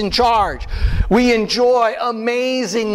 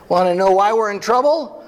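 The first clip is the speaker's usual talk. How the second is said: ordinary talk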